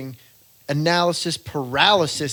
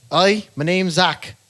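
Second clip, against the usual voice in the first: Irish immigrant accent; 4 semitones higher, 5 dB louder than usual. Shown as a demonstration. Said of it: "you just have to say".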